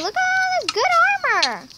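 squeaky high delight; excited. Real, not acted. Spots armor mid-panic.

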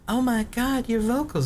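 very high fangirl squeal of words; high-pitched mock swooning; gushing girls. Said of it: "all the girls would just be like".

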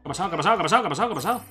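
agudo, atropellado, repitiendo la pregunta; agitado, sobresaltado. Real, not acted. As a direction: protesta aguda de jugador atacado por sorpresa. Panics as game enemies attack him. (alarmado y muy agudo)

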